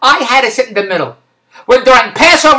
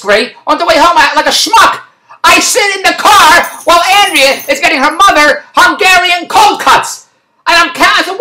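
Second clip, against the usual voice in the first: ordinary talk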